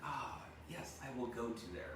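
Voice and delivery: breathy sigh of temptation; lured in. Retold or imitated. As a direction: give in to the smell. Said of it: "I'm like".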